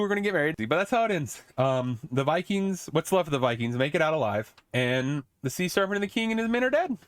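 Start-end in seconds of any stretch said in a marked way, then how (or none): none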